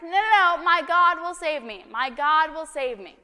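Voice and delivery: loud, high, insistent refusal; stubborn flood victim refusing help, loud and high; stubborn believer. Acted. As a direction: cry out no like nothing could move him. Man refuses helicopter.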